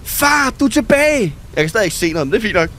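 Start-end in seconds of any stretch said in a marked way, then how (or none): 0.0-1.3 high-pitched, relieved exclamation; high, happy cry of welcome; excited greeting. Acted. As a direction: exclaim it high, delighted he's back. Reacting to dad being cured.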